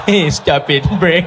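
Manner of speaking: half-hearted scold through laughter; laughing scold. Retold or imitated. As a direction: try to scold but crack up.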